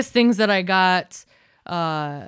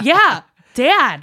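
louder, higher insistence; exasperated retort; 6 semitones higher, 8 dB louder than usual. Retold or imitated. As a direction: throw it back at him as if it's obvious. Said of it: "I would look at him".

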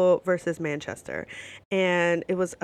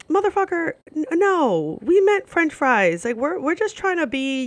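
high, exasperated mock outburst; indignant protest; 9 semitones higher, 4 dB louder than usual. Customer handed the wrong chips, indignant. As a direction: protest in raised-pitch disbelief.

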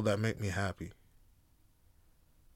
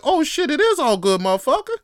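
high, loud, delighted realization; sudden hyped realization; 13 semitones higher, 6 dB louder than usual. Retold or imitated. You realize it's all good, loud hype. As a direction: it all clicks, shout it. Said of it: "You're like".

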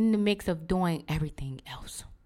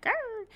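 high mock dog growl; 4 dB quieter than usual. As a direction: imitate a little dog's grr. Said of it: "he'll be like".

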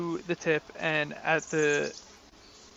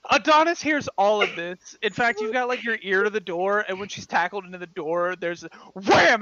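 normal talking voice

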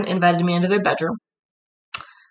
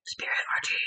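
breathy secret whisper; 13 dB quieter, faster than usual. Acted. Whispered joke.

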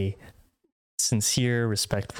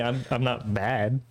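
ordinary talk, nothing marked

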